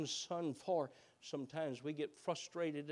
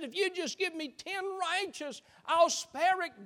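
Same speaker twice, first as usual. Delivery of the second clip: much higher, pressing final offer; urgent high plea; 11 semitones higher, 7 dB louder than usual. Acted. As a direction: push the last offer up high. God's last bargain, high.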